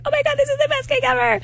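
very high-pitched fan squeal of praise; mock gushing. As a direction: gush in a high, exaggerated voice. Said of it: "they also were like".

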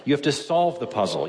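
ordinary delivery

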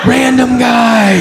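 comic horror at caller name; dread. Retold or imitated. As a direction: stretch it out in mock horror. Realizing who calls.

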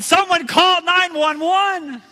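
high, loud, slow call for help; frantic yell. Retold or imitated. Bitten boy yelling to the crowd for help. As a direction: shout for help across the beach. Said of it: "I yelled".

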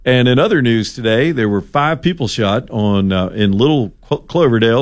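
ordinary delivery

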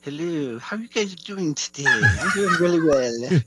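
high, put-on imitation of the host; Nathan Mum impression. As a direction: lift the voice and mimic the host's greeting. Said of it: "he's doing a mum impression".

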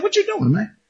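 curious question. Retold or imitated. Retelling a chat with Moses.

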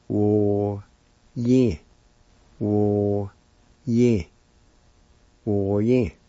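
Wo, then Ye, then Wo ye joined; syllable demo. Shown as a demonstration. Teacher building up the word for pigeon, part by part. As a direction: build the word from its two parts. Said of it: "it's pronounced like this".

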